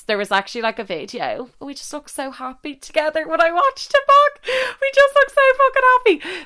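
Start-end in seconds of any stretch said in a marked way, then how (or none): 1.6-6.4 high squeal, far louder on the last line; squealing gush. Real, not acted. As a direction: gush over it like a giddy fan. Gushing squeal about video.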